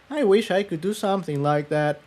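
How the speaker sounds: put-on high, soft, quick young mare voice; young filly Tiptoe. A narrator's reading. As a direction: voice the eager young pegasus, wistful. Tiptoe's voice in story.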